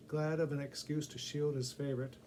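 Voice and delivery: ordinary delivery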